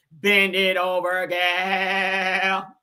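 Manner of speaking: slow, flat robot voice talking aggressively; robotic Martian. Retold or imitated. Host mocking a Martian robot voice, slow and robotic. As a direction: drag each word out like a bossy alien machine. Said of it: "Real robotic and shit".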